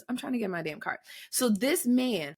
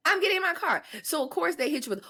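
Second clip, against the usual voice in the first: ordinary talk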